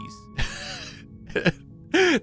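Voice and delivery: squeaky giggle; giggling at own joke; high giggle. Real, not acted. Laughs at own bit.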